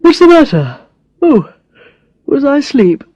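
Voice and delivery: high, startled just-woken voice; groggy surprise. Acted. Brad waking up beside Alex, startled high questions. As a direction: blink awake and ask, surprised.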